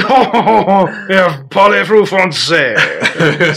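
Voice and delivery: drawn-out mock-French question; slow, laughing French parody; stuck-up French accent. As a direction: play a snooty French speaker. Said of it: "sounding like a stuck-up asshole".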